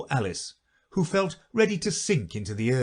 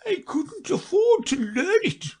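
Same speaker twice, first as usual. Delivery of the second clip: high, slow, wistful; a poor creature regretting; mild sighing regret; 3 dB louder than usual. Acted. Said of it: "said the Mock Turtle with a sigh".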